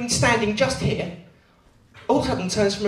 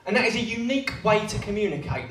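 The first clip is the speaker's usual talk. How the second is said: usual speaking voice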